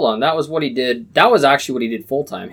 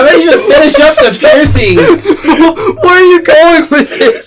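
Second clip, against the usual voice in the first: laughing; 12 semitones higher, 7 dB louder than usual. Real, not acted. Laughing at the story.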